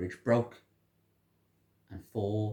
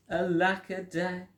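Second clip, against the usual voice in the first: mock lament; 7 semitones higher, slower than usual. A narrator's reading. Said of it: "And he cried".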